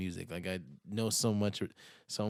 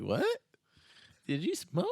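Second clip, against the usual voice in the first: put-on surprised girlfriend; 10 semitones higher than usual. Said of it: "she's gonna be like".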